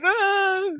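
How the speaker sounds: very high loud squeal; Garth's squeal. Retold or imitated. Garth reacting to Wayne's teasing, high loud squeal. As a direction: shriek like startled Garth. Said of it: "And then Garth".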